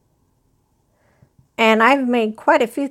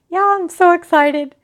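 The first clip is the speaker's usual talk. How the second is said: higher and a bit louder, bubbling over; excited squeal; 7 semitones higher, 4 dB louder than usual. Real, not acted. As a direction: tell the viewers, still squealing with joy.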